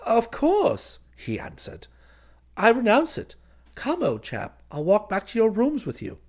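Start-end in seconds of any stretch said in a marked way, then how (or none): 0.1-1.0 very high, loud, eager assent; kindly cousin. A narrator's reading. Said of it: "said kindly". 2.6-6.2 high, loud, breezy coaxing; humouring cousin. Acted.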